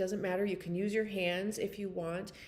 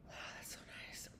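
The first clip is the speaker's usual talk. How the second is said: soft breathy whisper while sniffing oils; 19 dB quieter, much faster than usual. Real, not acted. Whispering to herself while sniffing her oils. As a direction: breathe the words, barely voiced.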